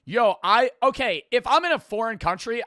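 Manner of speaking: loud, high, worked-up declaration; fired-up defiance. Real, not acted. Defying the quiz answer, loud fired-up shout. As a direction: shout it like you won't back down.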